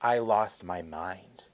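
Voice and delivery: low, slow, hushed confession; dramatic understatement. Retold or imitated. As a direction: drop low and draw it out. Dramatic retelling of her reaction.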